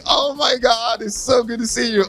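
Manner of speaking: high, delighted reunion squeal; excited squeal. Retold or imitated. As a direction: gush like seeing old friends. Excited reunion greeting on set.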